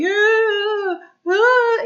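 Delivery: loud, high imitated moan; stressed groan. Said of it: "it's more stressful".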